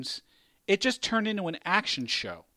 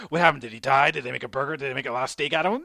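mocking his friend; 5 semitones lower, much faster than usual. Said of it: "you're like".

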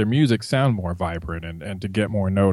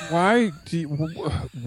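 ordinary delivery

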